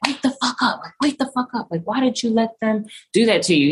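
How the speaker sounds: mock harsh scolding, high-pitched; retold angry berating. Attacker demanding she wake up, retold as mock angry scolding.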